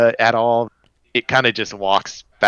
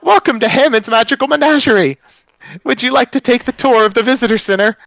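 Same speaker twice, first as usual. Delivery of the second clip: very high, loud and cracking, an eager stone guide; high cracking golem greeter; 14 semitones higher, 6 dB louder than usual. Acted. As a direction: squeak it out high and loud like a crystal creature welcoming guests.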